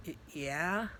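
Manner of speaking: small, soft stammer; hesitant, quiet mock-nervous reply. Natsuki answering the demon, nervously. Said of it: "of course she was nervous".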